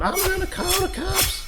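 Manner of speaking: panicked bartender shouting threats; yelling. Retold or imitated. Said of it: "Lynn's over here yelling".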